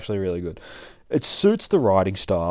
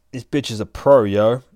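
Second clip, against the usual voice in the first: loud, higher, indignant; angry outburst; 3 semitones higher, 8 dB louder than usual. Acted. Blonde on the phone to her pimp, loud and indignant. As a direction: yell it down the phone, fuming.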